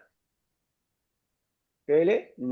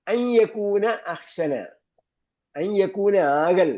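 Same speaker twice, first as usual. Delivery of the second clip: drawn out and raised, word by word; slow emphatic dictation; 4 semitones higher, much slower than usual. Shown as a demonstration. Teacher stressing the example sentence for students, slow. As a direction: spell it out slowly for the class.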